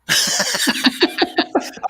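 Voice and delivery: short airy laugh, pitched high; breathy laugh. Real, not acted. Host chuckling at his own cheeky remark, airy laugh.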